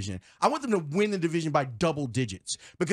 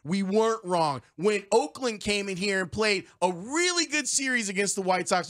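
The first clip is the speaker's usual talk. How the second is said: normal talking voice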